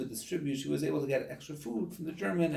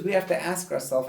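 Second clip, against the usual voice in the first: usual speaking voice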